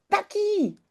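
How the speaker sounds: high-pitched held-back call; mock yell. Both children chase the runaway dog, mock yelling. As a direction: imitate children calling a dog without real volume. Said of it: "ze schreeuwen allebei heel hard".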